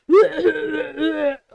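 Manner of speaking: imitating a stranger's uncovered hacking cough; loud mock coughing fit, spluttered out; imitated cough. Shopper coughing on the milk case, mock hacking cough. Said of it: "Did not cough ... Just went".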